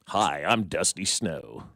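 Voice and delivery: low, very slow mock stripper introduction. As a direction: draw it out low as a put-on stripper. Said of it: "That's a great stripper name".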